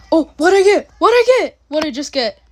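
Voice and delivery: high loud thrilled question; excited shout. Real, not acted. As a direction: yell it out in disbelief. Reaction to a rare reward.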